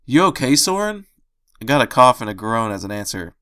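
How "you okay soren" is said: calling out loudly through a door; shout. A narrator's reading. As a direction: shout the question into the room. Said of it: "just enough to scream into it".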